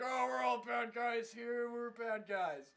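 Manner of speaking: slow, stretched bad-guy chorus; mock villains. Villains gathered at their base, mock menacing drawl. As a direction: drag the words out as hammy villains.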